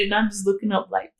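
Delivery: ordinary delivery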